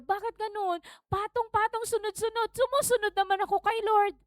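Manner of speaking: high-pitched, put-upon protest; imitated plaintive grumbling; mock complaint. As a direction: complain to God as a beleaguered believer. Acts a complaining believer.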